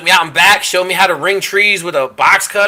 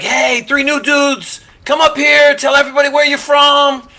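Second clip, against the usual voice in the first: calling out newcomers; 8 semitones higher than usual. Retold or imitated. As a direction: imitate summoning new guys before the whole team. Acted call-out at morning muster.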